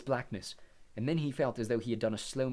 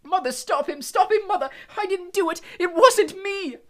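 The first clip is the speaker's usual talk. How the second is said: high, loud terrified begging; frantic boy, far higher and louder; panicked pleading; 12 dB louder than usual. Acted. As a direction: plead with mother to stop him. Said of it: "screamed the boy below".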